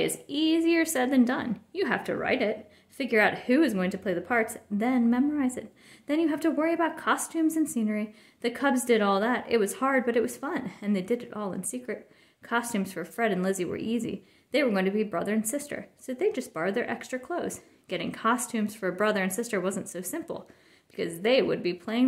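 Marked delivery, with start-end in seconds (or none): none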